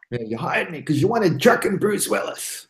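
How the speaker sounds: lightly voiced movie tough guy; Bruce Willis. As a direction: slip into a Bruce Willis impression. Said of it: "You can imagine him saying that".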